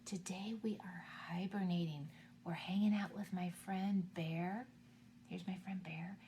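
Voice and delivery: soft unvoiced whisper for the little ones. Real, not acted. Teacher whispering to kids about hibernating bears. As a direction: hush it down to a whisper. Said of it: "It's time to be really quiet today".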